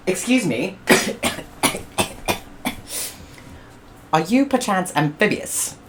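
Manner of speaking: high, polite inquiry; a fussy gentleman's question; prim English explorer. Acted. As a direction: George asks if amphibious. George asks politely.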